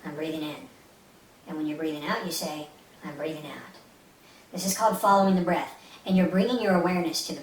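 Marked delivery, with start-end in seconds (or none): 0.0-0.7 soft, relaxed inner voice; hushed meditative self-talk; gentle, relaxed. Shown as a demonstration. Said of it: "gently say, in a relaxed voice". 3.0-3.8 very soft, low inner voice; hushed, relaxed self-talk; gentle, relaxed. Shown as a demonstration. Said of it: "gently say, in a relaxed voice".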